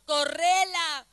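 loud, slow, stretched order; insistent command. Acted. God insisting to the reluctant believer, loud command. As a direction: hammer the command out slowly.

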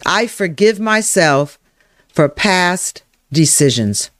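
slow, spaced phrases, paced for repeating; slow affirmation. Shown as a demonstration. Coach modelling an affirmation for listeners to type, slow and deliberate. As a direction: say it slowly and deliberately.

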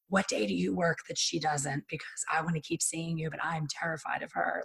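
hushed, fading to a whisper; secret hushed ask. Retold or imitated. Client whispering to stylist so the owner won't hear. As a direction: drop to a nervous whisper as if the owner could hear.

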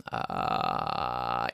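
low creaky fry; vocal fry. Shown as a demonstration. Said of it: "It's a vocal exercise".

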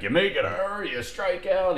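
high-pitched needling jeer; mock heckling. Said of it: "He's just riding".

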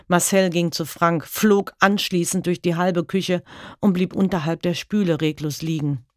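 usual speaking voice

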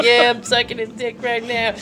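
high-pitched imitation of a brazen girl; brazen woman's quote. Storyteller voicing the girl to the caller, brazen and high.

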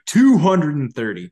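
loud, fed-up shout of the score; exasperated outburst. Real, not acted. Losing his league matchup, an exasperated loud outburst. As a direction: blurt the number out in disbelief.

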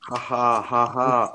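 slow, drawn-out real laugh; hearty laugh. Real, not acted. Host bursts out laughing.

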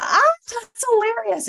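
high squealed amusement, acted out; mock delighted. Overhearing gossip about kids, high mock delight. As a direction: leap way up as if tickled by gossip. Her first amused thought, retold.

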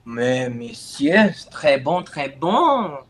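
hammed-up French approval; mock French.

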